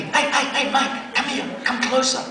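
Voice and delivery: high, eager call to come closer; youth worker calling out. Retold or imitated. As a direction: beckon him over, keen and a bit creepy. Van driver calling him over.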